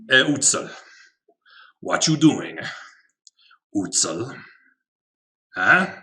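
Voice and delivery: a hint of a made-up South American accent, calling a creature; playfully addressing Utsal in a loose South American accent; vague South American accent. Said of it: "I don't know what that accent is. Maybe vaguely South American".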